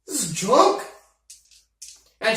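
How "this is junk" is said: louder, higher indignant scolding. Acted. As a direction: tear into the shoddy work. Orc scolds broken blade.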